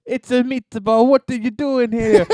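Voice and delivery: goofy meatball Brooklyn tough guy; Brooklyn Italian accent. Retold or imitated. Showing friends his Brooklyn accent, hammed-up. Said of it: "here, I got it".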